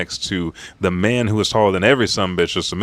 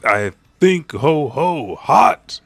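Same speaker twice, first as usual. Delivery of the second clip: high, loud yelps; mock yelping over hot food; Luffy eating hot food; 6 semitones higher, 6 dB louder, slower than usual. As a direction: stretch it out, hammed-up and loud. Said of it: "says Luffy as he's eating".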